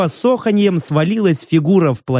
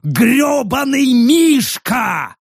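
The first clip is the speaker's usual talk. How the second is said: drawn-out, high straining curse; hoarse growl; 8 semitones higher, much slower than usual. A narrator's reading. Orc Rek curses the plush bear, hoarse growl. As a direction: rasp the curse slowly. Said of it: "захрипел Рек".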